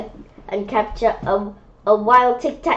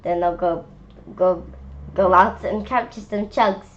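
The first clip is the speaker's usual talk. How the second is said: ordinary delivery, nothing marked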